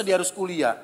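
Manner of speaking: normal talking voice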